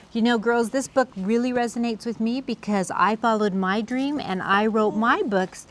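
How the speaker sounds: ordinary delivery, nothing marked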